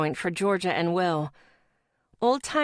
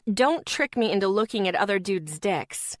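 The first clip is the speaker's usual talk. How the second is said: ordinary delivery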